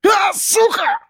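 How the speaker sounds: very high strangled scream; pained outcry. A narrator's reading. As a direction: cry out as teeth clamp your ear. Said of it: "Успел только выкрикнуть он".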